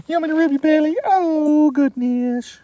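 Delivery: gushing over the dog's belly rub, squeaky high. Real, not acted. Baby talk to the dog.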